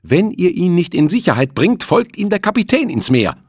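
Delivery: high, loud frantic shouting; hysterical threat. A narrator's reading. Juliette threatening the crew, shouting hysterically. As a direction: hurl the threat in near hysteria. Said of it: "schrie Juliette mit fast hysterischer Stimme".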